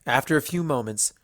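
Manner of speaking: plain reading voice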